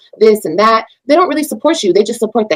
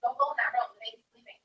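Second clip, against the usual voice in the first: hushed; 17 dB quieter than usual. Real, not acted. Hushing son, baby is sleeping.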